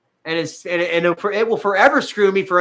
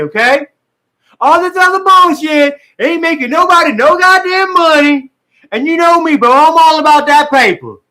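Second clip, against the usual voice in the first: much higher, loud furious dad yelling at Michael; imitating Dad; 10 semitones higher, 8 dB louder than usual. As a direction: roar it out like an angry father laying down the law. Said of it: "I was trying to sound like Dad".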